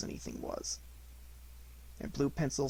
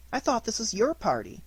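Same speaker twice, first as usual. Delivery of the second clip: lightly voiced timid piglet; higher, small and worried; 8 semitones higher than usual.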